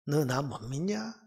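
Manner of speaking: soft, slow reproachful question; God. Acted. God answering the prophet's complaint, soft slow reproach. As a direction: ask it low, softly and slowly. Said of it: "이 불평 앞에 하나님의 대답이".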